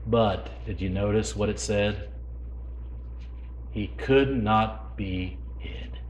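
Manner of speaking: breathy slow whisper of the key line; hushed whisper of the line. Real, not acted. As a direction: whisper it like a secret. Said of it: "whispered line in the hush".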